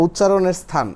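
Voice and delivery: ordinary talk, nothing marked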